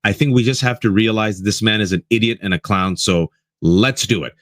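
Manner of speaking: ordinary delivery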